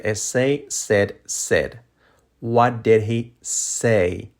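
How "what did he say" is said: slow, word-by-word question; slow model question. Shown as a demonstration. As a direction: spell it out slowly for learners. Same question, slowed for learners.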